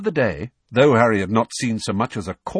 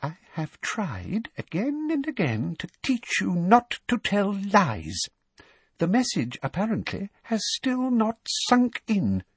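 contained rage; 11 semitones higher than usual. A narrator's reading. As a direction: start measured and high, build to the punishment. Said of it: "incandescent with rage".

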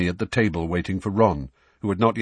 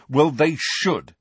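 higher, slow emphatic retort; forceful, indignant comeback; forcefully; 7 semitones higher, 4 dB louder, slower than usual. A narrator's reading. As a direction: insist firmly, leaning on each word. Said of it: "said Harry forcefully".

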